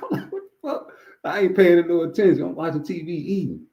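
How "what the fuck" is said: laughing disbelief. Real, not acted. Laughing at own story.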